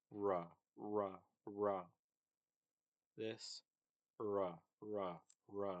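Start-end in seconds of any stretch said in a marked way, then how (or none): 0.1-1.8 "ra" with a small w glide after the r; r with a tiny w. Shown as a demonstration. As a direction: slip a tiny w into "ra". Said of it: "put it, the tiny W after it".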